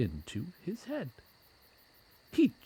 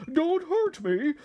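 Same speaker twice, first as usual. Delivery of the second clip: high, louder frightened plea; whimpering; 6 dB louder than usual. A narrator's reading. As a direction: beg like a terrified lion. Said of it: "he whimpered".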